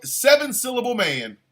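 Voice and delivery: ordinary talk, nothing marked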